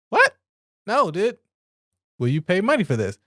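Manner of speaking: normal talking voice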